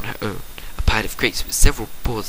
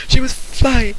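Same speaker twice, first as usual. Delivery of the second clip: much higher and louder, thrilled; excited; 13 semitones higher, 6 dB louder, much slower than usual. A narrator's reading. Narrator sharing the filly's first flight, excited and high. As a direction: burst out with joy.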